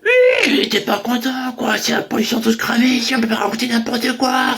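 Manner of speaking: high, strained put-on complaining voice; mock whiny complaint. [name] mimics the unhappy producer, high and whiny. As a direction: whine like an upset producer.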